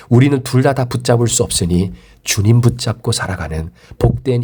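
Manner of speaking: low, measured pastoral prayer; closing prayer. Real, not acted. Pastor praying to God for the congregation, low solemn prayer.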